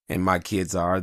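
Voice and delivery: usual speaking voice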